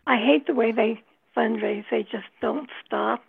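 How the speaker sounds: ordinary talk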